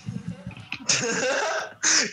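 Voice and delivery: high burst of laughter; high-pitched cackle. Real, not acted. Laughs before refusing.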